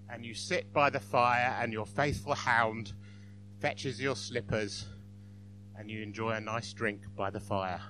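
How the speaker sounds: gently voiced, unhurried English gentleman; mild English gentleman. Joke's Englishman defining happiness to an interviewer, mild English gentleman. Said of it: "And the Englishman said".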